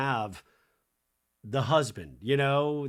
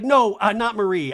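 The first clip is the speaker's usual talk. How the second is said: loud correction; 9 semitones higher, 11 dB louder than usual. Retold or imitated. Brittany corrects Andy loudly.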